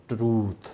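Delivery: ट्रूथ with a long ऊ and th at the end; correct pronunciation. Shown as a demonstration. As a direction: stretch the ऊ in ट्रूथ. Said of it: "थ sound एंड पे है". (ट्रूथ लंबा ऊ, आखिर में थ)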